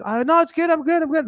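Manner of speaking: high-pitched, light brush-off; acted polite refusal. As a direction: wave off the offer, up high. Acts himself politely declining.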